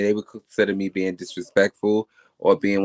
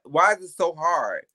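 usual speaking voice

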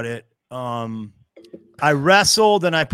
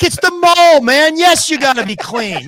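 high, loud mock scolding; mock exasperated; 9 semitones higher, 8 dB louder than usual. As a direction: shout it like it's obvious. Headliner scolding friend loudly.

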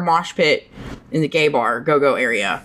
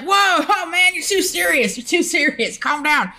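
high-pitched, startled plea to back off; alarmed protest; 11 semitones higher than usual. Retold or imitated. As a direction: protest in a high voice, trying to calm someone. Retold protest at too-rough moshing.

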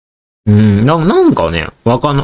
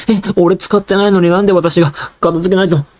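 put-on higher, mildly whining child complaint; whiny kid; 8 semitones higher than usual. Kid protesting cleanup he didn't cause, mild whine. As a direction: grumble like a small kid stuck tidying. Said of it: "みたいな".

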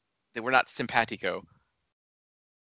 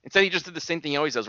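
usual speaking voice

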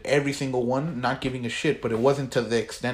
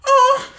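a shrill falsetto oh, much louder; weird high noise; 10 dB louder than usual. Retold or imitated. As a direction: shriek the oh in a creepy falsetto. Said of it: "that noise".

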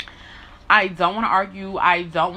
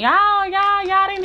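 high and loud, pleading; pained outcry; 12 semitones higher, 4 dB louder, slower than usual. Real, not acted. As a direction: call them out, voice jumping up. Emotional outburst.

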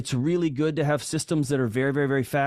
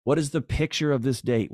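ordinary talk

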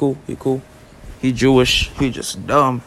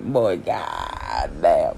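low, dragged-out groan of dread; slow sinking dread; 8 semitones lower, 6 dB quieter, much slower than usual. Retold or imitated. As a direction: drawl it out like doom is coming. Realizes mom will whoop him.

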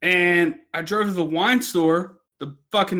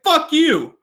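mock yell; 8 semitones higher, 4 dB louder than usual. Yelling at food truck staff.

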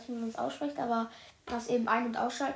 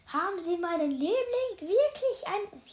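higher-pitched offended disbelief; indignant; 7 semitones higher than usual. A narrator's reading. Old woman confronts the cook over her darling, indignant. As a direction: take offence on the darling's behalf. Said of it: "Die Knesemeier schnappte empört".

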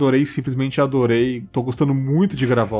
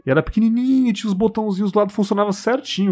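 excited; 9 semitones higher, faster than usual. Real, not acted. Childhood memory, tiny accordion.

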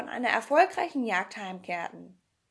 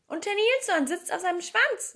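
put-on high-pitched excited girl's voice; high, eager child's exclamation; 8 semitones higher, 4 dB louder than usual. A narrator's reading. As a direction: chime in high, calling out the news. Child joins in excited calling out.

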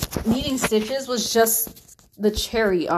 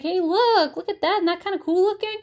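excited; 9 semitones higher than usual. Retold or imitated. Parents pointing wound out to child.

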